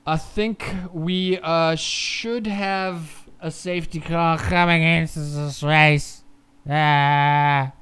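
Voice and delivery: slow, strained voice; voice giving out. Real, not acted. Streamer predicting the safety car's return, voice giving out. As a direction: drag the words out as the voice fails. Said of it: "Oh, my voice".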